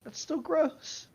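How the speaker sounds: high, slow, drawn-out disgust; still disgusted. Real, not acted. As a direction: stretch it out, unconvinced. Repeated disgust.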